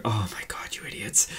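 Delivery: whispered groan at fools; exasperated mock whisper. Audience reaction, acted under breath.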